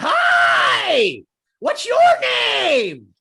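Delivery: bursting with fake cheer, slow and loud; over-the-top cheery greeting. Shown as a demonstration. Speaker greets the audience, cheery and overblown. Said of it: "That's magical".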